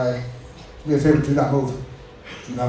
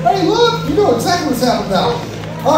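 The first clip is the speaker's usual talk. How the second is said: excited; 12 semitones higher, 4 dB louder than usual. Real, not acted. Hyping crowd for drum roll.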